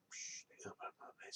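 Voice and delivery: whispered, almost unvoiced; whispered psh of the sprout opening; hushed whisper. Real, not acted. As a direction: breathe it out like a secret.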